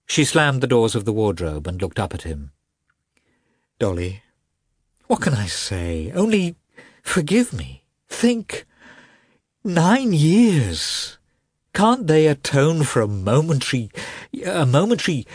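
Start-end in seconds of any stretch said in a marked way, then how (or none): 3.8-15.2 high, halting entreaty; desperate pleading. A narrator's reading. Husband begs wife to forgive him, desperate pleading. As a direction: beg in broken phrases, pitch climbing.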